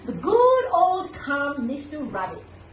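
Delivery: ordinary delivery, nothing marked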